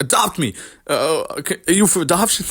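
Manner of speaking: half-hearted fighter impression; Chandler impression. Host who can't do the impression, trying it anyway. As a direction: attempt a rough impression, rising at the end. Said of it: "that's my Chandler impression".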